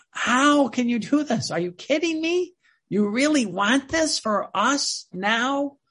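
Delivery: high-pitched, drawn-out disbelief; incredulous questioning. Acted. A believer questioning Jesus's plan, acted incredulity. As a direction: question it in amazement, pitch leaping up.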